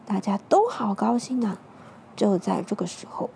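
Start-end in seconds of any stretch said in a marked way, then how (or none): none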